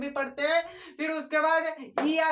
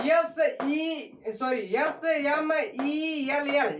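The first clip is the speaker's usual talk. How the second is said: slow letter-by-letter spelling; dictating the letters one at a time; spelled out slowly; much slower than usual. Shown as a demonstration. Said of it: "spelling out the mnemonic".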